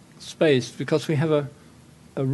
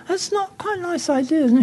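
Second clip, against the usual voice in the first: mock admiring critic; 12 semitones higher than usual. Mimicking architects touring schools.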